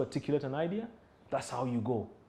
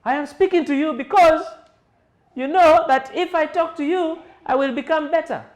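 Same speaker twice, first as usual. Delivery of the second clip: high and loud, straining; high strained voice; 11 semitones higher, 8 dB louder than usual. Shown as a demonstration. Trainer contrasting a high, strained, loud voice. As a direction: push it up too high. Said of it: "As compared to".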